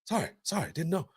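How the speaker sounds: soft, high sheepish sorry; a babysitter caught out, mumbling sorry; meek apology. Retold or imitated. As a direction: back down quietly and sheepishly.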